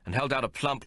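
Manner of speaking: usual speaking voice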